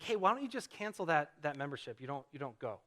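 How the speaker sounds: gentle, softening imitation of a wife; asking very nicely. Wife suggesting he cancel the gym membership, asking very nicely. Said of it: "Kelly asked me very nicely".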